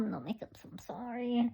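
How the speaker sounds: whispered apology; whispering. Real, not acted. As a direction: whisper it softly. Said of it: "I don't have no makeup, so I'm sorry".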